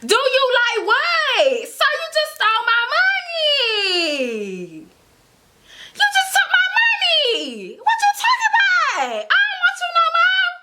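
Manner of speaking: high, laughing, incredulous shouting; mock outraged yell. Telling off the thieving boyfriend, high laughing mock outrage. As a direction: shriek it through laughter, half offended.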